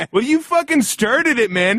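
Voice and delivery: high, loud indignant yell back; retold screaming. Narrator yelling back at his coworker on the plane, high mock shout. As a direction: shout the comeback like a furious grown child. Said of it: "we're going".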